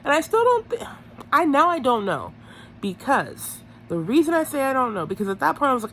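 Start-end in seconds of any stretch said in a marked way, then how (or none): none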